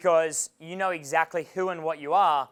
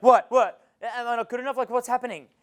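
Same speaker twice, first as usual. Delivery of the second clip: put-on upset girlfriend; 6 semitones higher, 5 dB louder than usual. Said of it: "And she's like".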